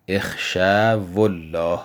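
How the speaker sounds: slow, careful reading of اخشوا الله; slow recitation. Shown as a demonstration. A teacher slowly recites the example under discussion. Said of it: "الان در مثال ما دقیقاً همینه".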